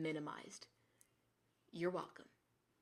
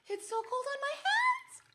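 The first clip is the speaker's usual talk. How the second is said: high squeal at the icy cold; 4 dB louder than usual. Real, not acted. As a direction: yelp it, shocked by freezing hands. Icy wet socks in hands.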